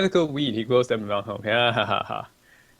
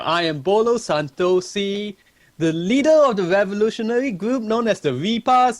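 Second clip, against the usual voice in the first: Malaysian accent impression; 8 semitones higher, 3 dB louder than usual. Streamer mimicking a game character's Malaysian accent. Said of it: "she's all like".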